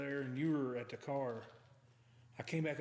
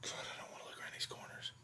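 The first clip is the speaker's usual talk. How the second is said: scared whisper; nervous night vlog whisper; 7 dB quieter than usual. Real, not acted. Scared in dark building.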